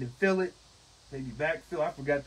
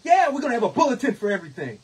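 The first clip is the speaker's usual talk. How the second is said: angry retort; 10 semitones higher, 9 dB louder, faster than usual. Retold or imitated. Sarcastic retort in union meeting.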